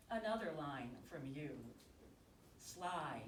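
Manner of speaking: slow, measured poem recital with long pauses; poetry reading. Acted. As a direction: linger on each short phrase, pausing between them. Reads own love poem aloud.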